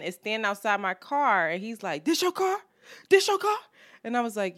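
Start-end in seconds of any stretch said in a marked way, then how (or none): 2.0-3.6 high-pitched mimicked man; stranger's voice. Man questioning her by the car, high mimicked voice.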